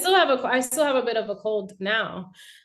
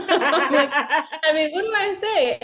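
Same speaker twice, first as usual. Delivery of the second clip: talking through a giggle, pitch rising; laughing shyly; 5 semitones higher, 5 dB louder, much slower than usual. Real, not acted. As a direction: laugh it out, unsure what to say. Shy guest asked about herself.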